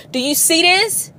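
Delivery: pressing the stuck driver to notice the siren; urgent repeat. Pretend. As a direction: call out "Do you see this?" again with real urgency. Repeats question, second louder.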